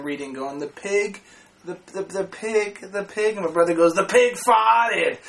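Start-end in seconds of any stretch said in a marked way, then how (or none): 0.6-3.4 slow, halting reading; imitated stutter. Kid reading aloud in class, stuttering. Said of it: "some kid was stuttering". 3.9-5.2 blurted in broad South Boston; slow, dropped-R Boston outburst; South Boston accent. Retold or imitated. Brother finishing the stuttering kid's sentence, South Boston accent. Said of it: "Is your brother from South Boston?".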